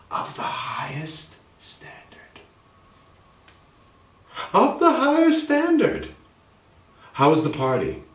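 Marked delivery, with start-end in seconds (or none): 0.1-2.4 slow, hushed whisper. Shown as a demonstration. Teacher whispering the phrase for students. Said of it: "demo of refined phrase". 4.5-6.1 much higher, slow and proud; grand and high. Shown as a demonstration. Teacher proclaiming the phrase high for students. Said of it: "demo of refined phrase".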